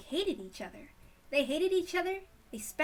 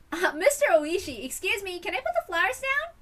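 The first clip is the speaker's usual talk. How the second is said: put-on higher, louder girlish voice; young girl voice; 8 semitones higher, 7 dB louder than usual. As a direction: voice Shion as a bright teen girl. Shion voiced, high and eager.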